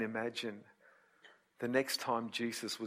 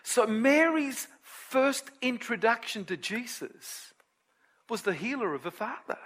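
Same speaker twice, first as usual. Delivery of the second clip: high, loud, ringing sermon voice; full-force preaching; 13 semitones higher, 8 dB louder than usual. Acted. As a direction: preach it out to the whole congregation. Preaching resumes after the aside.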